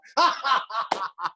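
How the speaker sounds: high, bright laugh; a quick burst of laughter; short laugh. Shown as a demonstration. Teacher laughing along with the class. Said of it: "hope they should also laugh".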